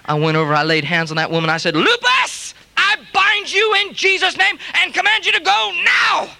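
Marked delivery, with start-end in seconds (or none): 1.7-6.3 much higher, forceful command; commanding rebuke. Retold or imitated. Preacher at the sick woman's bed, commanding lupus to go. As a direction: rebuke the disease with authority.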